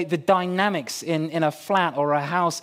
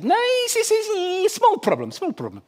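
high, hesitant Polish builder; Polish accent; 14 semitones higher than usual. Retold or imitated. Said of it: "he's got a very strange accent".

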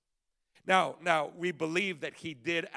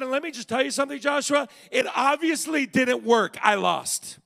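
put-on older mentor talking to his protege, higher and louder; playfully confessing a lost argument; imagined Moses; 7 semitones higher, 6 dB louder than usual. Moses warning young Joshua, hammed-up rueful mentor. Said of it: "said something probably like this".

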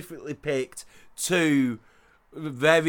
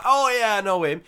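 high, lifted voice of sudden recognition; mock recognition; 6 semitones higher, 4 dB louder than usual. Imitating everyone recognising a comedian, high and keen. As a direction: blurt it out like everyone suddenly remembering. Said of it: "Everyone's like".

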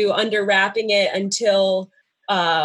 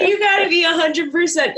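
laughing; 8 semitones higher than usual. Real, not acted. Laughing while restating the quote.

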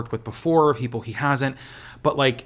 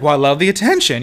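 camp quip; 3 semitones higher, 7 dB louder, faster than usual. Retold or imitated. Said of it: "he's like".

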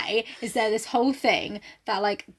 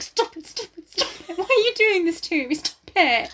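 higher-pitched, pleading mock protest; imagined mortified girlfriend; 8 semitones higher than usual. Girlfriend begging him during his speech, mock mortified. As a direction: beg him to stop, in a raised voice. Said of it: "can you just imagine her like".

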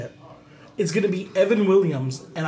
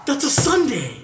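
excited high; 8 semitones higher, 3 dB louder than usual. Real, not acted. Excited exclamation.

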